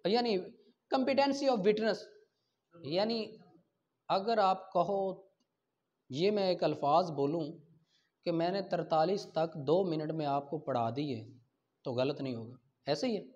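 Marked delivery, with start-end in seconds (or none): none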